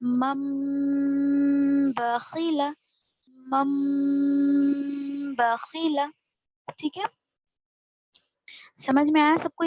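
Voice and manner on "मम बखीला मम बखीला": मम बखीला with मम hummed very long through the nose; ghunna for two alif. Shown as a demonstration. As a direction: hold the मम as a long nasal hum, then बखीला. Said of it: "नाक में ले जाकर आवाज को गुन्ना करेंगे". (मम को दो अलिफ तक नाक में गुन्ना करें)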